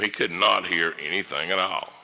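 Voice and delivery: ordinary delivery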